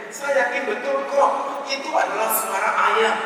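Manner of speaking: high-pitched, indignant wife; stamping insistence. Retold or imitated. Wife stamps her foot arguing with husband, indignant insistence. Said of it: "Sambil sang istri menghentakkan kakinya".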